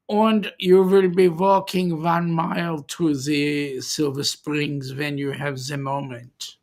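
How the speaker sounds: put-on German accent, opening on a big "Ah". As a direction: greet them in a hammed-up German accent. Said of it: "accent quite right".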